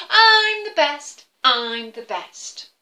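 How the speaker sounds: high, loud, slow bragging boast; chanting I'm the best, proud and slow; boastful eagle. Acted. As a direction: crow it out like a show-off eagle. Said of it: "what's he saying?".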